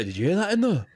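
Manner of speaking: high-pitched catchphrase quote; Carol impression. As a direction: mimic her startled question for fun. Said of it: "all the time we just go".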